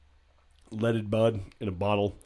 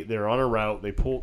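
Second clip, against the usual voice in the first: ordinary delivery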